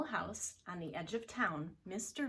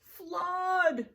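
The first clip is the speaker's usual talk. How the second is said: high, loud cry of alarm; a father bear raising the alarm; loud high shout; 11 semitones higher, 11 dB louder than usual. Acted. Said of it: "said Mister Blair".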